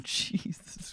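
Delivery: hushed, breathless whisper of shock; whispered disbelief. Retold or imitated. Stunned at his line, whispered Jesus. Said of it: "And I was like".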